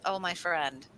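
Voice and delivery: soft, low, calm reassurance; quiet, unbothered warrior wisdom; calm soft Viking. Retold or imitated. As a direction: settle him gently like a wise old friend. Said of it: "And he goes".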